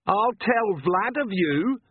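high, slow mock scream; screamed threat. A narrator's reading. Captive threatening to tell on vampire, restrained scream. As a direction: cry it out high without full volume. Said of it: "Perdita screamed in Agnes's voice".

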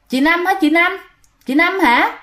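grandma calling to a figure at the window, puzzled; calling out unsure. Retold or imitated. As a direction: call out to a shape at the window.

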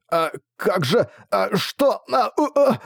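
high frightened stammer; terrified mumbling. Acted. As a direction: babble in terror, teeth chattering. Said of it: "невнятное бормотание наряду со стуком зубов".

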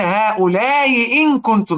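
"هؤلاء إن كنتم" with the hamza of "إن" eased, slow; softened hamza demo. Shown as a demonstration. Teacher demonstrating eased hamza on "هؤلاء إن كنتم" to students. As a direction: ease the hamza in "إن" gently, drawn out. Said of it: "اسمع كده".

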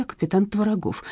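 usual speaking voice

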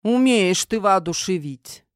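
ordinary delivery

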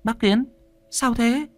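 high, anxious call to an uncle; alarmed question. Acted. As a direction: call out in worried alarm, pitched well up. Woman calls to stunned old man.

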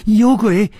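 high, loud cry of fright; panicked shout. A narrator's reading. Terrified woman fleeing the toilet, high panicked shout. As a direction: shout the alarm as you bolt. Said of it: "大叫着往外跑".

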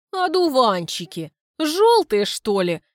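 mock the word одуванчики, high and loud; a woman mocking her suitor's compliment, scornful; mocking echo. Lyuda mocks compliment.

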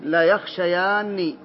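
teacher's slow model of لا يخشيان for a student; slow model answer. Shown as a demonstration. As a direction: stress لا in لا يخشيان and draw the phrase out very slowly. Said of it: "أبا المنذر".

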